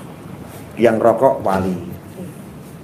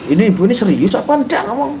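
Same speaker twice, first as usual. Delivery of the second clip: incredulous; 9 semitones higher, faster than usual. Real, not acted. Shocked challenge to her claim.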